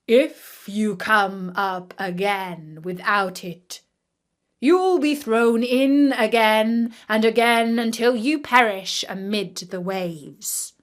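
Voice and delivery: stern king. A narrator's reading. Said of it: "and added".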